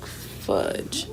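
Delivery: breathy under-the-breath fudge; a soft annoyed whisper; whispered curse. Retold or imitated. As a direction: mutter it to yourself, defeated. Back at car, shoes ruined.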